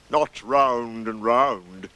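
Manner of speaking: very slow, drawn-out correction; slow, dour donkey voice; Eeyore. Acted. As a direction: stretch each word ponderously. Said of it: "said Eeyore".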